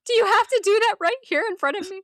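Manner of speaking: high, loud distressed complaint; upset wife. Retold or imitated. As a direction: cry out at him, appalled. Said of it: "I just imagine her just".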